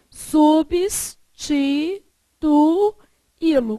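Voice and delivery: Substituí-lo drawn out very slowly, each syllable separated. Shown as a demonstration. Teacher sounds out the word for the class, very slow. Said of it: "Qual que é a regra de substituí-lo?".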